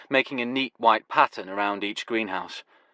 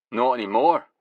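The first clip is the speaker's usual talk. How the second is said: put-on Glasgow accent. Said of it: "his Glasgow accent now going full throttle".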